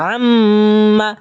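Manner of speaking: "আম্মা" with the double m hummed long, short final a; level chanted hum inside "আম্মা"; ghunnah nasal hold. Shown as a demonstration. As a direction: linger on the m of "আম্মা". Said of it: "গুণনা, ঠিক আছে?".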